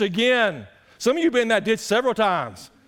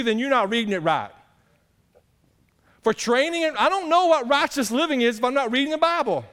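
high, loud, driving sermon voice; full-force preaching; 7 semitones higher, 4 dB louder than usual. Acted. Pastor driving the point home to his church, at full force. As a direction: preach it hard to the congregation.

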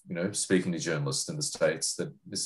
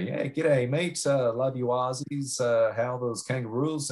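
higher-pitched imitation of Americans greeting an Aussie; imitated friendly Americans; 6 semitones higher than usual. Americans greeting an Australian reporter, high-pitched imitation. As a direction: put on a high voice, mimicking keen locals. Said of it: "they say".

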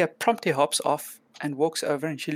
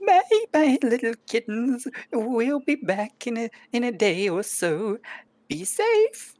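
very high, loud sweet farewell; singsong witch goodbye; 8 semitones higher, 3 dB louder than usual. Acted. Witch waves goodbye to kittens, very high singsong.

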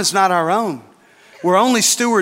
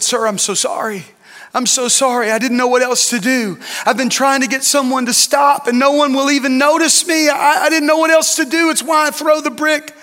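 put-on pleading child; 7 semitones higher than usual. Boy pleads in retold story.

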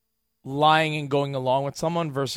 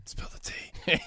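whisper; 7 dB quieter, slower than usual. Real, not acted. Co-host whispering the slang phrase back, unvoiced. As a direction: whisper the phrase spill the tea under the breath.

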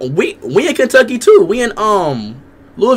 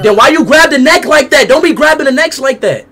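raised, heated telling-off; angry scolding; 6 semitones higher than usual. Real, not acted. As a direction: scold her sharply. Scolding the girl over the cats.

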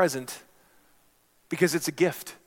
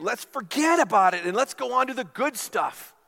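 higher, brushing-off bidder voice; dismissive bidder; 8 semitones higher, 6 dB louder than usual. Acted. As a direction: wave it off impatiently.

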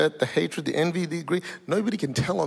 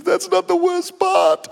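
high, drawn-out comic wail; hammed-up crying voice, slow; mock tearful; 5 dB louder, slower than usual. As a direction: wail that worse news is coming, as a put-on weeper. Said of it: "She's in tears".

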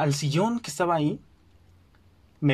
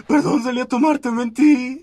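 much higher, louder crying confession; tearful confession; 8 semitones higher, 6 dB louder than usual. Retold or imitated. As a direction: blurt it out to mom as tears start. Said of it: "Y empecé a llorar otra vez".

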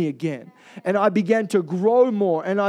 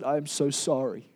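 tearful apology; 5 semitones lower, 5 dB quieter than usual. Retold or imitated. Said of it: "I was weeping".

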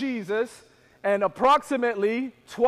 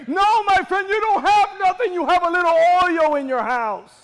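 loud, high, climbing to a shouted climax; forceful preaching; 7 semitones higher, 9 dB louder than usual. Real, not acted. Preacher telling the congregation God gave the idea, forceful preaching.

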